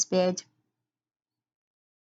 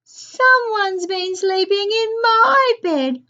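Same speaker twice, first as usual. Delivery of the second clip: high, loud wailing bear cub; Baby Bear, wailing; 10 semitones higher, 6 dB louder than usual. A narrator's reading. Baby Bear finds someone in his bed, high loud wail. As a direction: wail it out like an upset little cub. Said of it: "wailed Baby Bear".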